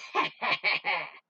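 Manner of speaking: short mocking villain laugh; sneering laugh. Villain Luna gloating over the pilots, sneering laugh.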